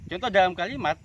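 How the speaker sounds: usual speaking voice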